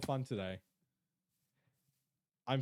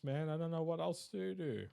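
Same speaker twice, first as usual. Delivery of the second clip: ordinary talk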